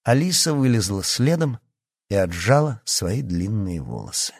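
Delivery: usual speaking voice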